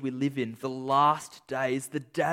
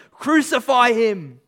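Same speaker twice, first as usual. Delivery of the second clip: loud, high yell with drawn-out words; crowd yelling; 8 semitones higher, 10 dB louder, slower than usual. Acted. Preacher acting out the crowd at the crucifixion, yelling. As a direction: yell it out like an angry mob. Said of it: "there in the crowds yelling".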